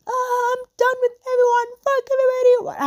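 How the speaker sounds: high squeaky mock tantrum voice; mocking Jojo. Retelling the host's meltdown, high mock whine. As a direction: shriek it like a spoiled brat. Jojo's tantrum mocked in quote.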